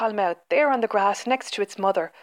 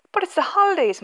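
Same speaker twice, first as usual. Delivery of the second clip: moaning protest; 11 semitones higher, 4 dB louder than usual.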